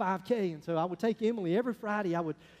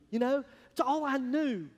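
ordinary talk, nothing marked

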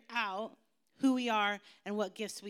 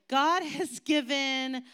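higher, louder, slow God has given; slow emphatic; 5 semitones higher, 7 dB louder, much slower than usual. Shown as a demonstration. Pastor prompting the kids to finish the verse, slow emphatic. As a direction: draw each word out.

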